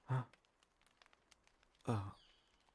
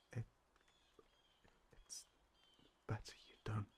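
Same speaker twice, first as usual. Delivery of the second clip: whisper; 5 dB quieter, faster than usual. Acted. Warning to keep secret.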